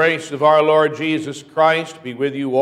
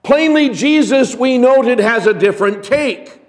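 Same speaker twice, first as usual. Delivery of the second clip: higher and louder, an urgent pulpit delivery; full-force preaching; 8 semitones higher, 4 dB louder than usual. Acted. Pastor drives home the Beatitudes to congregation, preaching. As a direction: preach it out to the whole church.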